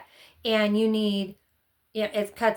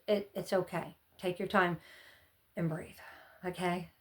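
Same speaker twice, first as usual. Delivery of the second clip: gentle; 3 semitones lower, 9 dB quieter than usual. Real, not acted. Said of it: "soothing viewers".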